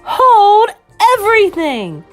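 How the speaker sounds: high, loud trumpet-like shriek; drawn-out shrieking command. Acted. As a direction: shriek it across a ballroom. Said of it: "Her shrieking voice echoed through the ballroom like a trumpet".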